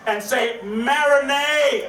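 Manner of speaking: slow, shouted marinate; drawn-out crowd call; rallying shout. Real, not acted. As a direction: stretch it out and pump the room. Calling crowd to repeat word.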